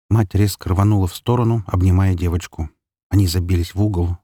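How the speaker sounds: ordinary delivery, nothing marked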